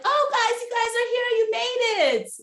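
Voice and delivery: very high-pitched, gushing phony greeting; fake surprise. Imitating a castmate who acted like she didn't know, fake surprise. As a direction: mimic someone pretending to be thrilled. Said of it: "she was all bacon like".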